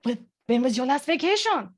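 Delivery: put-on a light, clumsy French accent on the question; imitating a French colleague with a bad French accent. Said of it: "my terrible, terrible French accent".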